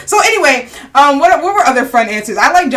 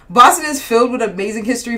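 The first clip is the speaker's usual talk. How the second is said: ordinary talk, nothing marked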